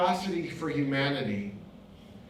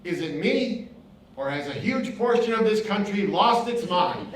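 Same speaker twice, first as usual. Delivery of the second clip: exasperated question; 5 semitones higher, 5 dB louder than usual. Retold or imitated. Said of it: "You say".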